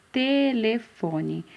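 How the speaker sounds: telefone with a long TE; drawn-out first syllable. Shown as a demonstration. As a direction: stretch telefone slowly, syllable by syllable. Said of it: "qual o primeiro som que sai".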